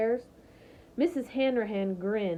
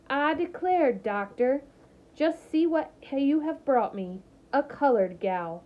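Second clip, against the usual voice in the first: put-on Southern accent; 5 semitones higher than usual. A narrator's reading. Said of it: "a southern accent thick enough".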